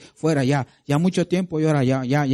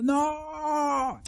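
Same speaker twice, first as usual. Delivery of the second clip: high cry of no; running-off refusal; 12 semitones higher, 5 dB quieter than usual. Acted. Pastor acting out the mourner running down the street, high cry. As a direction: fling it over your shoulder, high.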